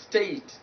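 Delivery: "state" with a gliding ay; diphthong ay. Shown as a demonstration. Teacher giving an example word, diphthong ay. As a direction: let the vowel of "state" glide towards i. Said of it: "not state, but state".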